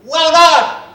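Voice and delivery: high, loud cheer of well done; shouted praise. Real, not acted. Comedian cheering the crowd for their answer, shouting. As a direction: shout your approval back at them.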